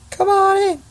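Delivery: very high, squeaky welcome; squeaky teacher try. Acted. Small teacher greeting the class in a very high voice. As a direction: squeak it out like a tiny cartoon man. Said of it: "What am I doing?".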